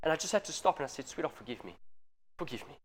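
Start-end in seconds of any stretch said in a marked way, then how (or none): 1.1-2.8 hushed, tender plea for forgiveness; soft apology to daughter. Retold or imitated. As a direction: gently say sorry to a little girl. Father apologising to his daughter.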